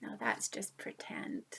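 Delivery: hushed whisper. Real, not acted. Listener whispering to reassure about the story. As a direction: reassure softly under the breath.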